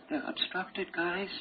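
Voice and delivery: soft, breathy punchline to the class; softly letting them in on it; hushed aside. Real, not acted. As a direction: drop the voice to a near murmur.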